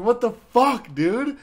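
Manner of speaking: loud, high mock-outraged shout; indignant outburst. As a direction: confront your prankster dad at full volume. Confronting dad about the prank.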